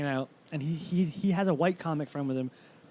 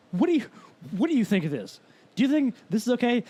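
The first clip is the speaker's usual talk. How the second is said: high, louder questioning; incredulous appeal; 7 semitones higher, 4 dB louder than usual. Retold or imitated. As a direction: look for backup in disbelief.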